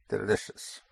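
ordinary delivery

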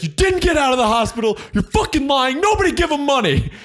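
high, loud mock outburst; confronting a liar. Imitating a passenger calling out the panhandler, shouted confrontation. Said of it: "people stand up to him".

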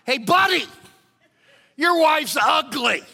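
high, loud squawking parrot call; a bird jeering at a passing husband, loud and high; parrot yelling. Retold or imitated. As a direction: heckle him like a rude parrot. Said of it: "the parrot yells out".

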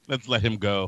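deep, lazy cop voice; low cop voice. Retold or imitated. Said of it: "He's like".

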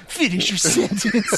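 hammed-up cop catchphrase; imitated movie cop. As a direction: imitate the dubbed cop, cracking up. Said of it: "And he's like".

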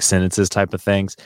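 normal talking voice

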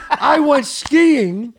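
slow, laughing words; laughing through words. Real, not acted. Laughing while telling.

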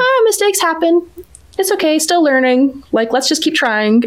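put-on carefree child voice. Daughter shrugging off a mistake, lightly voiced carefree child. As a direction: toss it off like a kid unbothered by mistakes. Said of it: "who's like".

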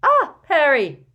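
high, drawn-out, very loud greeting; loud exclamation. A narrator's reading. Mrs Weasley spots Harry entering, loud high exclamation. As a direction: burst out with his name.

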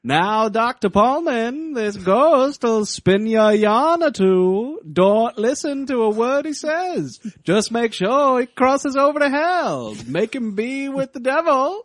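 put-on high, slow, drawn-out Maine accent; put-on Maine sheriff giving a grave warning; hammed-up New England accent, stretched out. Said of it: "No, it's Maine".

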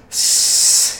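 long, sharp sibilant hiss; loud creature hiss. A narrator's reading. Gollum answering Bilbo's question with a loud hiss. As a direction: hiss hard through the teeth. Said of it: "hissed Gollum".

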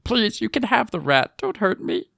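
high, pleading captive voice; terrified begging. Acted. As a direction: beg pitifully in a squeaky prisoner's voice. Prisoner begging captors for mercy.

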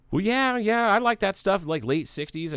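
hammed-up eager customer voice; eager old man. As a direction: lightly play the old guy lighting up. Imitating old customer's eager reply.

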